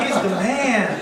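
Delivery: "man" drawn out with emphasis; admiring stress on MAN. Acted. Man praising David Lynch, "man" drawn out. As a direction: stretch "man" slowly in admiration, laughing. Clarifies admiring sense of phrase.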